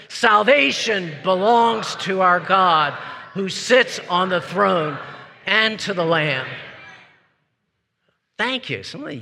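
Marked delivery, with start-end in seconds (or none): 0.1-6.5 slow, forceful group chant; rousing loud cry. Shown as a demonstration. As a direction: lead the crowd, hitting each phrase firmly. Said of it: "we can rock the courts of heaven".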